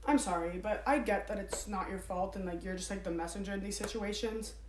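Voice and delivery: soft, calm complaint on the phone; calm but annoyed. Real, not acted. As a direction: voice frustration quietly and politely. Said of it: "Did you think I was gonna yell".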